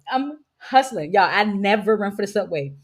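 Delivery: usual speaking voice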